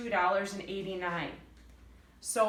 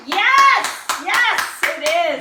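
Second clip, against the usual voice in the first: excited cheering; 9 semitones higher, 15 dB louder, much slower than usual. Acted. Cheering own question about amazing.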